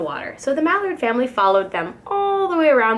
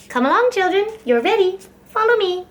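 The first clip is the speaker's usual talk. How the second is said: much higher, louder call to the little ones; calling mother duck; 9 semitones higher, 3 dB louder than usual. A narrator's reading. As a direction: gather the children with a bright motherly call. Mother calling ducklings to follow.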